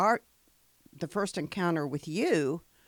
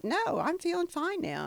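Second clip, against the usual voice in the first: much higher-pitched, playful self-quote; breezy retold retort; 13 semitones higher than usual. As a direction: toss back a high, airy reassurance. Acts out her breezy reply.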